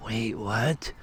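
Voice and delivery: very soft, bewildered double-take; retold stunned disbelief. Speaker hearing the same message twice, quietly stunned. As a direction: drop right down in volume for a baffled reaction.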